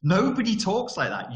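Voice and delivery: high-pitched incredulous objection; exasperated protest. Retold or imitated. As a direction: call out the fake voice, pitched right up. Mock protest at the tape announcer.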